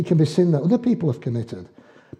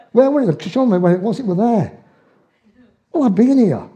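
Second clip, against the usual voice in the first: incredulous arguing; 6 semitones higher than usual. Retold or imitated. Husband argues with his wife over where the wallet was, incredulous. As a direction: protest like a baffled husband.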